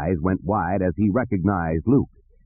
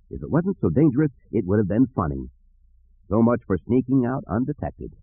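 usual speaking voice

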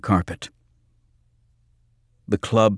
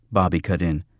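ordinary delivery

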